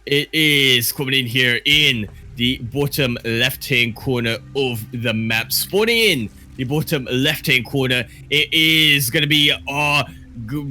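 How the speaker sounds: loud Aussie caster intro; Aussie accent. Pretend. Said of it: "he just went full Aussie on me".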